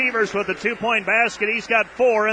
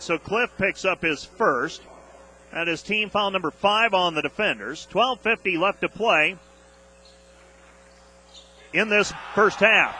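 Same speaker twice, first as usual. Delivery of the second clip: ordinary talk, nothing marked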